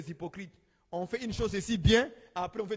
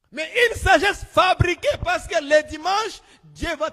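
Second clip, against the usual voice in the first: preaching shout; 7 semitones higher, 8 dB louder than usual. Acted. Preaching at full force.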